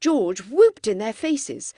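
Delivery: usual speaking voice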